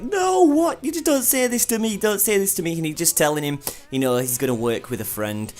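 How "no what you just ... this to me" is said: shocked outburst. Retold or imitated. Soma protesting to his father, shocked high pleading. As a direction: leap up the register in disbelief, then plead. Soma hears the restaurant is closing.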